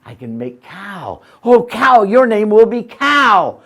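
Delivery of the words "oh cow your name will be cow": loud and high. Acted. Man naming the cow.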